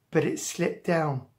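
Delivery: plain reading voice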